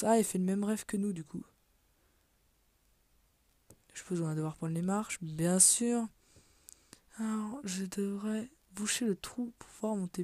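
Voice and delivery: ordinary talk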